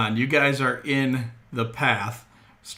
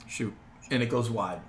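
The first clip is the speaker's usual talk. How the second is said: ordinary delivery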